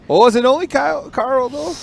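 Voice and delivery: loud, high playful ribbing; loud teasing. Real, not acted. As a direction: tease him, loud and high. Teasing a guest over a game.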